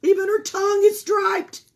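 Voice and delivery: high loud astonished exclaim; doctor marveling at the striped tongue; amazed. Acted.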